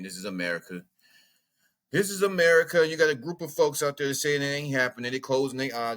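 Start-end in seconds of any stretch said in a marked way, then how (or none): 0.0-0.8 low and quiet. Real, not acted. Said twice, low then loud. 1.9-2.9 louder emphatic. Real, not acted. Said twice, low then loud.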